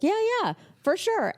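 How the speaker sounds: very high, easy yes; breezy agreement. Retold or imitated. Boss agreeing to give feedback, breezy. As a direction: agree readily, voice lifted way up.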